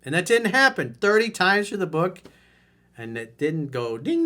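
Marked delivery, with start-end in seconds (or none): none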